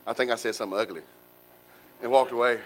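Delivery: ordinary talk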